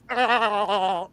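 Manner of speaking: short hammed-up strangled yelp; a mock death yelp; mock death cry. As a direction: cry out like a man dying. Dying man flaps like fish.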